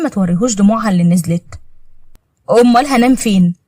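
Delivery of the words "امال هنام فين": ordinary delivery, nothing marked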